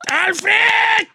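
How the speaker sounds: high, long-drawn challenge yell; King Kong. Pretend. Host plays himself chest-beating outside Alfredo's place, King Kong yell. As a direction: bellow the name in mock chest-beating bravado. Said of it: "Así como King Kong".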